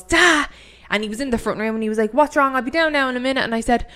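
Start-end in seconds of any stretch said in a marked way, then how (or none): 0.0-0.5 high, loud yell up the stairs; retold scream. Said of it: "I screamed up the stairs". 2.1-3.4 higher and louder, calling from another room; dad calling out. Retold or imitated.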